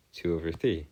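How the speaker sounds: normal talking voice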